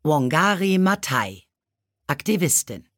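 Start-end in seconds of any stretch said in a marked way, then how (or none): none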